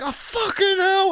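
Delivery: high, slow mock despair; mock exasperation. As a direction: sigh it out, fed up. Retold exasperated thought.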